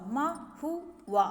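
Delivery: महुआ drawn out very slowly as ma-hu-aa, every sound clear; careful demo pronunciation. Shown as a demonstration. Teacher showing students the right word, slow and careful. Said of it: "तो यहाँ पे महुआ होगा".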